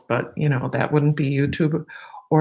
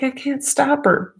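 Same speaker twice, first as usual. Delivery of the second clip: mock husband; 9 semitones higher than usual. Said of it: "and he's like".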